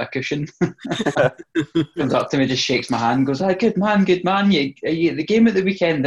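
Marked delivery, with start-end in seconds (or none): 3.4-6.1 high, hearty mock Irish voice; strong Irish accent. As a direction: imitate a chummy Irishman, pitched well up. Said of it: "this strong Irish accent going".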